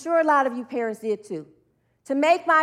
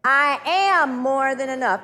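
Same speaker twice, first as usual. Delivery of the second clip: slow, drawn-out declaration; stretched, emphatic affirmation; leading an affirmation; 4 dB louder, slower than usual. Shown as a demonstration. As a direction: say it slowly and firmly for others to join.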